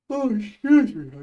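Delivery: words stretched through a real yawn. Real, not acted. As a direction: say it mid-yawn, slow and loud. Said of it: "again with my yawning".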